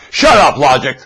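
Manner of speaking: loud shouted command; mock yell. Preacher voicing sellers yelling at logic, a loud shout. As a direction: yell it like they do. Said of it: "That's what they're yelling".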